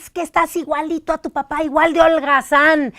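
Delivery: a hint of an exasperated mother; scolding mother. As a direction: lightly act out a mom nagging her son. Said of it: "Entonces tú le dices".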